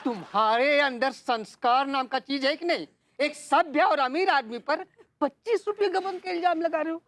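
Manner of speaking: high-pitched, offended protest; indignant outburst. Acted. Accused man protesting his honour, shrill indignation. Indignant denial of embezzlement charge.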